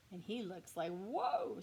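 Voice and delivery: usual speaking voice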